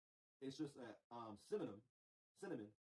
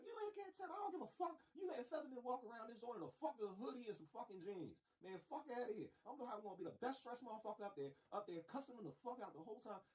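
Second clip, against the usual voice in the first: very high, animated mock rant; 8 semitones higher than usual. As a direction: rant like a cussing congressman, pitched way up. Acted rant in Congress.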